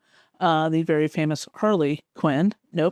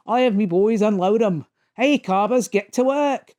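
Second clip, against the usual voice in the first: hammed-up Australian accent, pitched higher; 4 semitones higher than usual. Australian villain ordering his boys to work, hammed-up Australian accent. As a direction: play up a comic-book Australian accent. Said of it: "he's got his accent".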